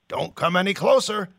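a hint of a threatening guard; menacing. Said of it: "John says, menacing with his blade".

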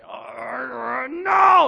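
high shouted cry of no; rebellious protest. Retold or imitated. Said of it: "something in us just rebels and says".

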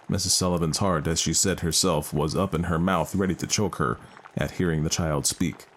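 ordinary delivery, nothing marked

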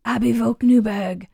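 creeping nightmare whisper. Pretend. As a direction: rasp it out like a creepy host. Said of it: "in this weird raspy whispery voice".

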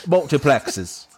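attempted Cockney. Shown as a demonstration. Co-host struggling to say the word, slow Cockney. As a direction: stretch "Multiplexes" out in bad Cockney. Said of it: "proper Cockney".

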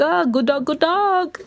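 high, lilting street greeting; greeting friends. Acted. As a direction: call out a hello to friends. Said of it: "if I meet my friends on the street".

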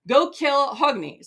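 imperious mock order to underlings; commanding. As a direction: issue a slow, loud command. Atli gives an acted kill order.

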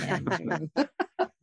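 real laugh at her own joke; short amused laugh; bright laugh. Real, not acted. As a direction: laugh it off after the grand line.

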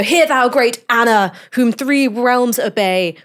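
grand, slow verse recital, pitched high; mock a lecturer loudly declaiming poetry; theatrical, drawn-out quotation; declaiming tutor. Tutor declaims verse to his class.

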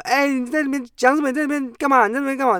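high, nagging mock wife voice; imitating wife. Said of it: "你太太在外面敲门".

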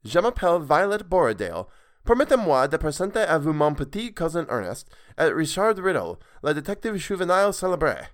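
put-on second try at the girl's French introduction; French introduction retake. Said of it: "that wasn't even Violet's voice".

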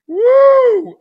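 loud high whoop; loud high excited woo. Real, not acted. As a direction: let out a big woo. Excited whoop before stories.

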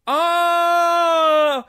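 loud high shout into laughter; burst of laughter. Real, not acted. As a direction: explode laughing as it clicks. Finally getting the joke.

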